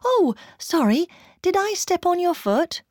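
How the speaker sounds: high-pitched, acted apology; apologizing. A narrator's reading. English person who stepped on a foot, apologizing. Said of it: "Then there was all this apologizing".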